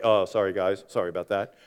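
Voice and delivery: low, soft, shrugging mock apology; offhand apology. Crashed driver on team radio, low and offhand. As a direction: toss off a careless sorry. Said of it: "you hear his radio and he's like".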